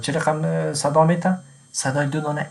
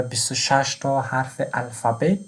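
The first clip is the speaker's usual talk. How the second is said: ordinary talk, nothing marked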